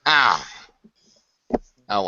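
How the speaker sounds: ordinary delivery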